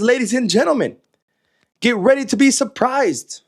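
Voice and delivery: high-pitched mock build-up; sarcastic. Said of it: "That's, of course, sarcasm".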